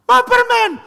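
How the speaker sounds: high, loud mock tantrum yell; hammed-up child's scream. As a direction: shriek a demand like a toddler. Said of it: "teriak lebih keras".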